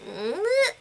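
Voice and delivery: high rising noise of reluctance; Robert. Retold or imitated. Robert reacting to the adoption, high uncertain noise.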